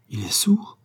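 high, rising astonished question; surprised, pitch leaping up; stunned realisation. A narrator's reading. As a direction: echo it as a revelation. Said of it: "ce mot fut une révélation".

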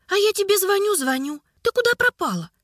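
high, fast anxious chatter; rattling off. A narrator's reading. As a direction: rattle it off nervously, sugary. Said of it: "затараторила Глафира".

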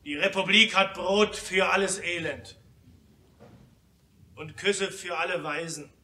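usual speaking voice

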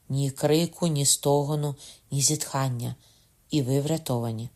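plain reading voice

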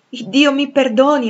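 slow, loud, high-pitched plea; fervent invocation. Acted. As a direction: call on God with fervour. Suitor invokes God's pardon. (invocazione accorata, lenta e forte)

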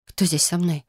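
ordinary delivery

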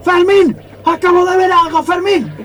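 high shout of a name into fog. Acted. Muguruza shouts for Fermín across the cloud. Calling partner through cloud.

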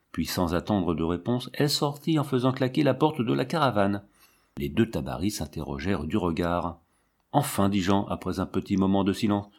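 ordinary delivery

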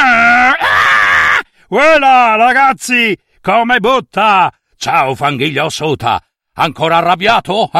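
high, loud cocky biker voice; reckless zombie biker. Acted. Zombie biker teasing the grumpy skeleton, brash and loud. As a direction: swagger in shouting greetings. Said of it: "spericolato zombie centauro".

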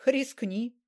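a scornful, snorting dare; scoffing snort. A narrator's reading. Mityai daring Laskovin to test the sect, scoffing. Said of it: "фыркнул Митяй".

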